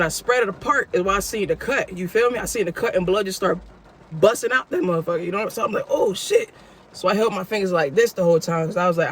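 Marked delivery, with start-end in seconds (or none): 5.9-6.5 sudden high "Oh, shit"; shocked. Retold or imitated. Narrator seeing his bloody hand, shocked. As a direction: react to seeing your hand bleed.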